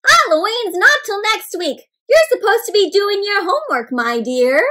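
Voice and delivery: high, sing-out motherly reminder; storybook mother. A narrator's reading. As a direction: chide your child fondly from above. Mother scolding gently in story.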